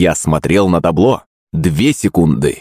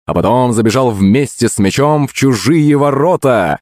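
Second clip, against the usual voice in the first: plain reading voice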